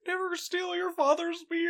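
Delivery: high, strained lecturing voice; Jordan Peterson impression. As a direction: preach it like a stern self-help guru. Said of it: "something that Jordan Peterson would say".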